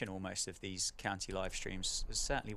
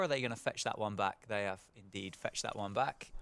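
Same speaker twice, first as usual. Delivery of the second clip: normal talking voice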